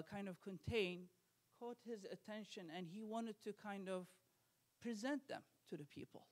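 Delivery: usual speaking voice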